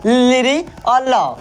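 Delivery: very slow लिरी और ल; slow demo. Shown as a demonstration. Teacher slowly sounding out लिरी और ल. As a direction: drag the sounds out for the class. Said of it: "बोल के देखो तो".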